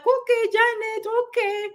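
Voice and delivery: high soothed "okay, okay" from a client; imitating clients. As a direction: play the reassured client agreeing. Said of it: "they're like".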